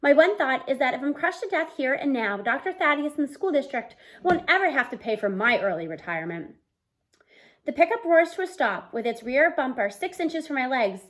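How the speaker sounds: usual speaking voice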